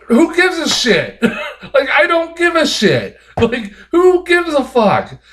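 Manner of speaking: incredulous chuckling rant; laughing exasperation. Real, not acted. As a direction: laugh it off in disbelief. Laughing at the film's plot.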